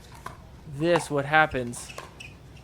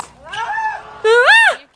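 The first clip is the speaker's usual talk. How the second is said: very high, loud squeal; piercing involuntary squeal; imitated squeal; 10 dB louder than usual. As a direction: squeal as if it slipped out. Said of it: "Nothing like an involuntary squeal".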